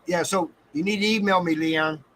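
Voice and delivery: ordinary talk, nothing marked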